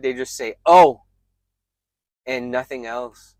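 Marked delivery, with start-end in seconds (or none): 0.6-1.0 loud, higher, abrupt "Oh"; unimpressed reply. Retold or imitated. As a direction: mimic a dismissive one-word reply. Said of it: "I don't really know how to describe it".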